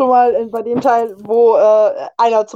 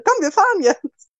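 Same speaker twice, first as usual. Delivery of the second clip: friend's voice; 8 semitones higher than usual. Retold or imitated.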